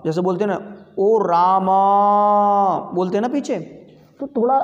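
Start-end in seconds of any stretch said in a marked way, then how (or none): 1.0-2.9 final vowel of "Rama" held long on a level note; drawn-out, sustained "Rama" as if calling someone; prolonged calling vowel. Shown as a demonstration. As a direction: call out "ओ Rama", stretching the last vowel. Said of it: "जब हम किसी को पुकारते हैं".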